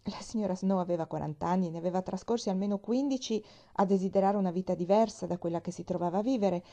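usual speaking voice

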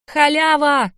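loud, high yell from the crowd; gleeful shout. A narrator's reading. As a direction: holler it for everyone to hear. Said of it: "закричал кто-то".

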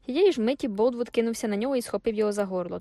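usual speaking voice

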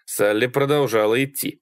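usual speaking voice

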